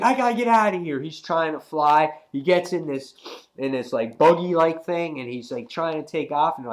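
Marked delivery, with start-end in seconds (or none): 0.0-1.0 panicked escape. Retold or imitated.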